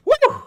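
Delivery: loud, very high "woo"; real high-pitched whoop of amazement. Real, not acted. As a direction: whoop loudly at a savage comeback. Exclaims at governor's comeback.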